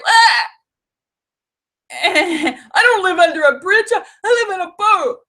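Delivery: high, loud laughing mock indignation; mock indignant troll. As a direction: squeak it like the offended troll. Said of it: "And he's like".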